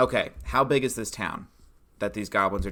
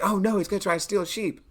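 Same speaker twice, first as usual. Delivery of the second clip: mock alarm; 10 semitones higher, faster than usual. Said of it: "It's like".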